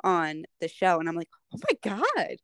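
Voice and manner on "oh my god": high, breathy amazed gush; amazed swooning. Retold or imitated. Fan retelling her reaction to the actor's accents, amazed and swooning. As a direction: gush it, stretching the words.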